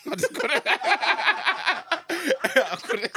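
high, breaking up with laughter; words swallowed by big laughs; laughing. Real, not acted. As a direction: try to speak through helpless laughter. Cracking up about his scoring.

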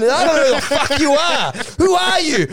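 high, loud demand; hammed-up bewildered shouting; outraged disbelief. Acted outrage at stranger.

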